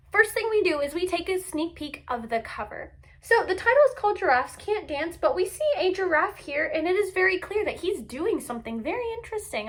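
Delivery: usual speaking voice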